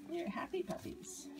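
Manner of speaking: hushed whisper. Real, not acted. Whispering to the drowsy puppies. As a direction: whisper so you don't wake them.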